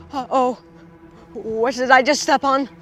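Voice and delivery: ordinary talk, nothing marked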